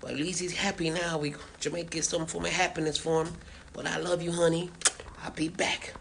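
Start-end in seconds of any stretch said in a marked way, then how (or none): 5.2-5.9 unvoiced whisper; hushed parting words. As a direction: breathe the promise in a whisper. Whispered goodbye.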